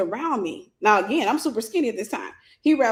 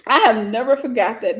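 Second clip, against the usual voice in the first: ordinary talk, nothing marked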